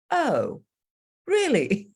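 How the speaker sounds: high-pitched mild surprise; imitated surprised daughter. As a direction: imitate a daughter taken aback, pitch climbing. Voices the daughter's surprised reply.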